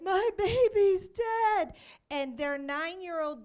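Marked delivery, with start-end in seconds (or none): 0.0-1.7 loud, high, slow retold wail; imitated grief-stricken shout, drawn out; mother's heart-wrenching scream. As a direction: cry out as an anguished mother, acted out. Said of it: "this heart-wrenching scream from the mother".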